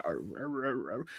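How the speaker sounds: low, very soft growling grumble; bulldog grumble. Retold or imitated. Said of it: "the bulldog grumble that bulldogs have".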